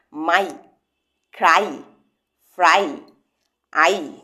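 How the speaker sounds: মাই, ক্রাই, ফ্রাই, আই each said slowly and separately, ending in a clear long "ai"; a teacher's demo of the y-as-ai sound; teacher sounding out words. Shown as a demonstration. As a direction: model each word with a clean final "ai" sound. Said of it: "উচ্চারণ করো".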